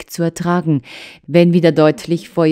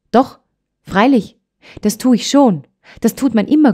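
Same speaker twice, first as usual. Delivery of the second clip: assuring; 7 semitones higher, 4 dB louder than usual. Acted. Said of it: "versicherte das Trini".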